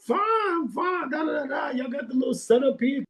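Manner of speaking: very high, singsong small talk; mock greeting reply. Customer answering the restaurant lady, high mock-friendly voice. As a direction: answer in a squeaky put-on pitch.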